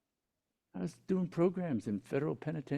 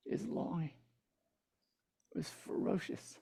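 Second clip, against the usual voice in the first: hushed emphasis; 2 semitones higher, 11 dB quieter than usual. Real, not acted. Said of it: "dramatic slow fade on longing".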